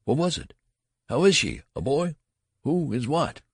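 high, urgent volley of questions; frantic questioning. A narrator's reading. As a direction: fire off anxious questions. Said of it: "as he came up in a gasping rush".

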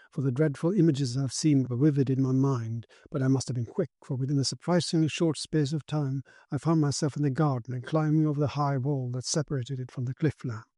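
plain reading voice

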